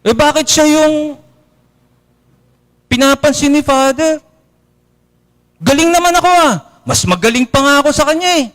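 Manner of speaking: high whiny mock complaint; high-pitched jealous grumble; envious parishioner. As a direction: sulk about Father's favourite.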